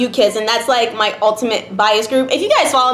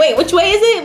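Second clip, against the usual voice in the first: confused; 6 semitones higher than usual. Retold or imitated. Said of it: "confused, like".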